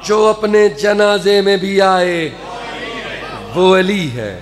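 solemn recitation. Acted. Re-recites the line.

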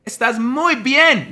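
high, loud, slow mock praise; mock cheering praise. Mocking the praise people crave, high and loud. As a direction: squeal out fake approval.

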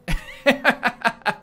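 high, loud burst of laughter. Real, not acted. Player has no idea of abilities, high laughing.

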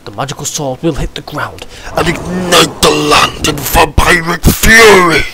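slow booming demon voice; vampire voice. Acted. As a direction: proclaim it like a monster. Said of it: "that's enough for the vampire voice".

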